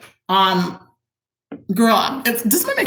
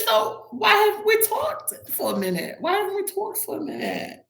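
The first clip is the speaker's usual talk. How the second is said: high put-on voice; 13 semitones higher than usual. Retold line in a high voice.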